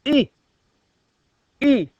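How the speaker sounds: nasal pinched-nose ee, loud; holding nose. Shown as a demonstration. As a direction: say it with your nose held. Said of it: "hold your nose as though you smelt a smell".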